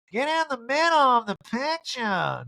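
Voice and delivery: high, drawn-out mock urging; [name]. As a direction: usher them in with a stretched high voice. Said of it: "[name], now assisting the pink lady".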